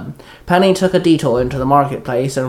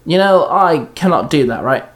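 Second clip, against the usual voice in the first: plain reading voice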